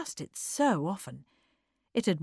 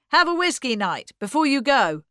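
loud, higher call across room; 6 semitones higher, 7 dB louder than usual. A narrator's reading. Harry offering a parting drink, calling out loudly. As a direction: call it out to departing guests. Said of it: "called Harry".

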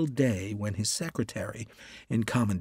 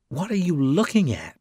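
a touch of sharp annoyance; mild irritated edge; sharply; 6 semitones higher, 3 dB louder than usual. A narrator's reading. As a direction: snap the question at him. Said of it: "he added sharply".

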